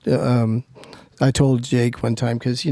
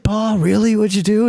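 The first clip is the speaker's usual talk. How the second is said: put-on excited kid; 8 semitones higher than usual. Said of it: "He goes".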